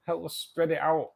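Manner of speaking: normal talking voice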